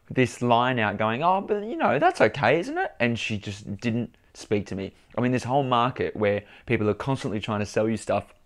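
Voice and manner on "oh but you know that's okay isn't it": very high-pitched, placating retelling; laughing it off. Retold or imitated. Customer pushing a line to the shopkeeper, high-pitched laughing it off. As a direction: plead lightly in a squeaky high voice. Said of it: "I sort of laughed it out".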